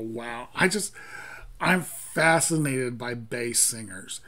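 ordinary delivery

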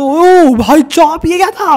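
loud, high, slow stretched outcry; shocked yell. Real, not acted. Gamer hit by his friend's plane, shocked loud yell. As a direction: cry out in disbelief. Rival crashes into him.

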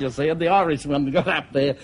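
ordinary delivery

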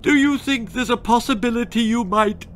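high, loud, frantic plea; panicky mother. Acted. Mother fearing for her daughter, high frantic plea. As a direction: spiral into panic.

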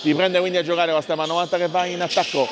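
normal talking voice

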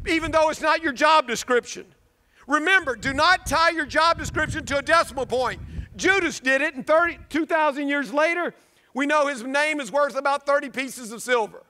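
ordinary delivery

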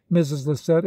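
usual speaking voice

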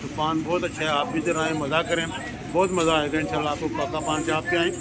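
talking around large paan; mouth full of paan. Real, not acted. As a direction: cheeks stuffed while speaking. Seen 2: Large paan put in mouth at -4s; cheeks stay puffed throughout.

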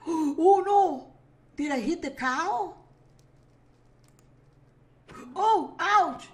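loud, high panicked yelps; real startled yelling at the mishap; sudden high cry of dismay; alarmed shout. Real, not acted. Accidentally hits the cow.

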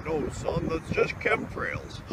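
whispered aside, unvoiced; conspiratorial whisper; hushed secret. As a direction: let it slip in a hush. God whispers secret to son.

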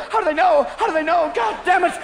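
high, frantic outburst; panic, pitch jumping up; paranoid panic. Acted. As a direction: freak out like a paranoid stoned driver. Paranoid stoned driver panics at radio.

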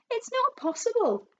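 very high, loud wail of disbelief; crying out. A narrator's reading. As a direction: squeal it out in protest. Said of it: "cried Missus Twit".

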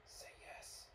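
soft, voiceless prompt; whisper. Acted. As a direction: whisper the order into his ear. Faber's voice whispers in his ear.

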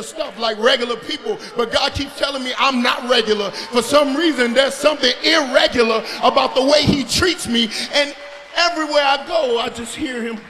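loud, impassioned testimony voice; full-force preaching. Acted. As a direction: build to a shouted confession.

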